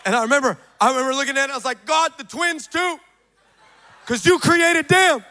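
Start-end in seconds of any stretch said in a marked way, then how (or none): 1.9-5.2 high, slow, loud mock plea; pleading protest. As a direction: argue with God in hammed-up dismay. Acted protest to God over poster.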